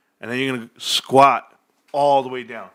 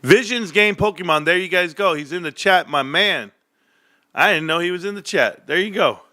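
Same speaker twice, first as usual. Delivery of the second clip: ordinary talk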